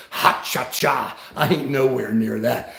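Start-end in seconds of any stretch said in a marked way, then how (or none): 0.1-1.2 slow, low catchphrase impression; Jimmy Durante. Grandpa quoting a beloved old comedian, slow catchphrase impression. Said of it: "Look up Jimmy Durante".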